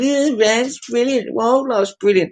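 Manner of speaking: high, lightly voiced gushing fan; mocking fans. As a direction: send up an over-keen fan. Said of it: "they're going".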